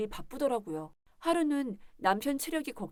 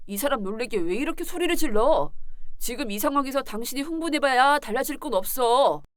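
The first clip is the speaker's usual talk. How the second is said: louder, impatient telling-off; irritated rebuke; 6 dB louder than usual. Acted. Husband shields his lover from wife, rebuking irritably. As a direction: shut her protest down firmly.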